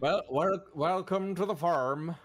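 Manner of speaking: high, slow rustic farmer. Acted. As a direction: drawl it like a country farmer. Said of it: "that's the farmer voice".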